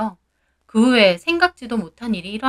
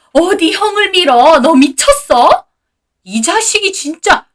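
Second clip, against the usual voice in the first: much higher, louder shrieking; frantic outrage; 7 semitones higher, 7 dB louder than usual. A narrator's reading. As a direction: shriek at the son in panic. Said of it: "초상이라도 난 양 난리가 나셨고요". (난리가 난 시부모의 고함)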